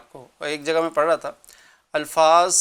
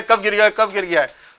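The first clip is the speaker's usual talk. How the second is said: loud, higher, irritated repeat; angry scolding; 4 semitones higher, 5 dB louder than usual. Retold or imitated. Scolding the man who dropped the cup, irritated and loud. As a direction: nag him angrily about the cup. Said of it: "غصے میں اس کو یہ بول رہا ہے".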